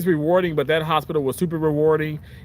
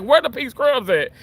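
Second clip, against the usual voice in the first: imitating upset coworkers; 10 semitones higher than usual. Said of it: "they're like".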